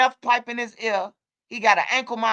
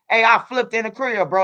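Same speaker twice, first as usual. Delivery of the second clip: normal talking voice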